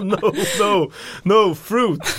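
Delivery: high, loud mock exasperation; exasperated protest. Traveller re-enacting protest to shop staff who brought Fanta, exasperated. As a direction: protest it loudly, half laughing at the memory.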